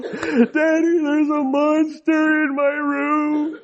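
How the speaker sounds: high-pitched, wailing toddler imitation; drawn-out mock sobbing in a small child's voice; crying little boy. Son bursts in to tell dad about a monster, mock crying. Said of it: "he's crying, and he's like".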